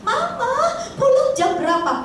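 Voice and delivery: lightly voiced child, high call of Mama; a hint of a little child pleading; child asking mother. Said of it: "Itu selalu dia bilang". (anak kecil bertanya manja)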